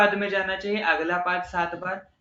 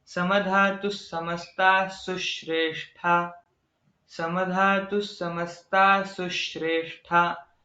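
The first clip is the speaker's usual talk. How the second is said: slow recitation with a light stress on "सु" in "सुश्रेष्ठा"; stress before a conjunct; slower than usual. Shown as a demonstration. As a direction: lean on "सु" just before "श्रे". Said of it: "थोड़ा सा जोर देना चाहिए".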